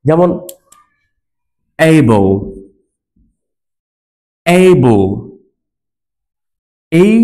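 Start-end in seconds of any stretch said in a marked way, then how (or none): 1.8-5.1 able as "ay-bol", no sound for the E; silent final E. Shown as a demonstration. Teacher models able with silent final E. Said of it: "এটার কোনো উচ্চারণ হবে না".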